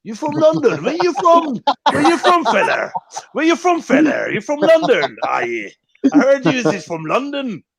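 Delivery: high-pitched mock local voice; hammed-up nosy locals, high questions dropping on "are you"; excited locals. Locals quizzing a visiting Londoner, high mocking voice. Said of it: "and they're like".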